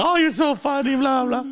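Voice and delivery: high-pitched, gushing mimicry; amused doctor imitation. Imitating the doctor laughing at his jokes, high and amused. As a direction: imitate her tickled reaction up high. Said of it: "she was just laughing".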